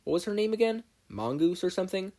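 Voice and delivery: bright high-pitched aside; high girl voice. A narrator's reading. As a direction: lift the voice up high.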